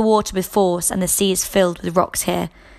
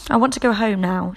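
plain reading voice

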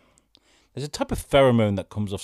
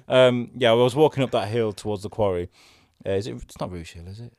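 ordinary talk, nothing marked

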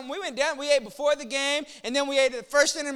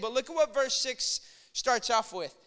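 ordinary talk

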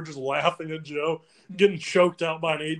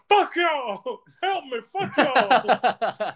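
high, drawn-out mock wail; mock wailing curses; 9 semitones higher, 4 dB louder, much slower than usual. Joe answering the laughing dorm, mock wailing curses. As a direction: imitate someone cursing and begging at once.

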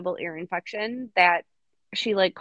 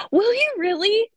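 higher, louder thrilled question; delighted gasp; 11 semitones higher, 6 dB louder than usual. Real, not acted. Friend thrilled she can be rude by FaceTime, gasping high and loud.

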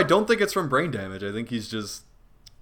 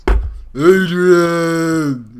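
louder mumbled Rocky cry of Adrian; Stallone calling Adrian; 3 semitones higher, 7 dB louder, much slower than usual. Acted. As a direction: call out Adrian like Rocky, mouth moving a lot. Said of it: "You just go".

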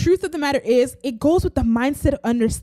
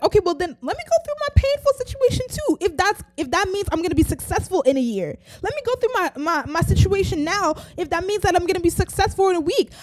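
eager; 6 semitones higher, faster than usual. Retold or imitated. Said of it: "you would be like".

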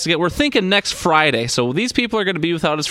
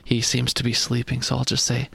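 ordinary talk

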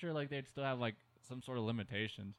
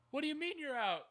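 much higher, whiny Costanza voice; George Costanza impression; 14 semitones higher than usual. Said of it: "my George Costanza impression".

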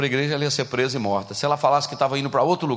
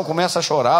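ordinary delivery

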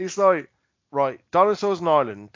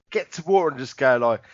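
normal talking voice

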